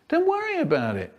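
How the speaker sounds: put-on very high reassuring child; high childish voice. Child reassuring Mum in a high voice. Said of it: "Okay, Mum".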